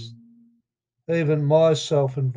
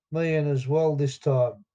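ordinary delivery

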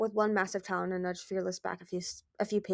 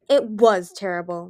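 slow, louder, understated accusation; accusing; 8 dB louder, slower than usual. A narrator's reading. Mighty Claw blaming Darkstalker over the island, accusing. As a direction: lean accusingly on the words, slowly. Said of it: "Mighty Claw said accusingly".

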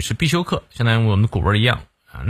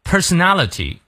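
"Personality" with the front raised high; front stress; 6 semitones higher than usual. Shown as a demonstration. Teacher showing learners stress placement, "Personality" stressed early. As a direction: lift the start of "Personality". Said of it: "重音在前".

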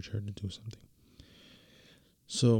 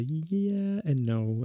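mock teasing drawl; 6 semitones higher, much slower than usual. Wife's teasing confession.

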